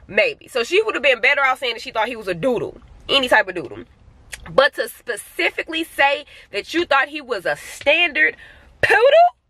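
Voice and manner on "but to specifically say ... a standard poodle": incredulous. Acted. Owner ranting at poodle mistake, building incredulous disbelief.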